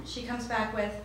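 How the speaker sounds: usual speaking voice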